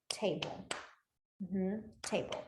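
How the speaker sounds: a soft unvoiced whisper of table. Shown as a demonstration. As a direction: breathe the word table without voice. Mouthing sign to class.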